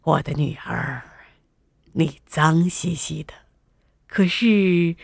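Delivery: low, gentle king's voice; tender father. A narrator's reading. King sitting beside his muddy daughter, tender.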